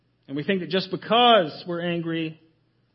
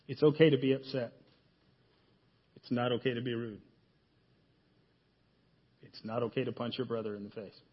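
put-on soft, calm reassurance to a child; gentle parent voice; 9 semitones lower, 6 dB quieter, faster than usual. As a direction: quietly explain the rules to your kids. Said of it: "We tell our kids all the time".

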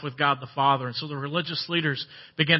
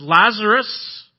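a slow, louder call with the name stretched; 5 semitones higher, 7 dB louder, much slower than usual. Retold or imitated. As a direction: call him out of the tomb. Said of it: "he called out and he said".

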